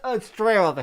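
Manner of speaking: coughing through a tight mask, voice pinched; real coughs, words squeezed out between them; choking in mask. Real, not acted. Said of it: "it feels like I'm being strangled".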